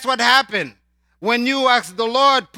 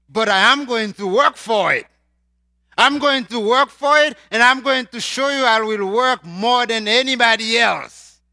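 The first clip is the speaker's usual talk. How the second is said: louder, higher preaching burst; passionate declaration; 3 semitones higher, 5 dB louder than usual. Real, not acted. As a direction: rise up in determination.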